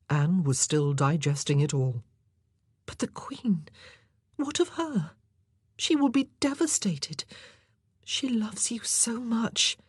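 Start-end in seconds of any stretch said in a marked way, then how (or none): 2.9-9.8 very high, hushed, whispery woman's voice; dismayed young courtier. A narrator's reading. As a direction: plead gently for someone absent, high and breathless.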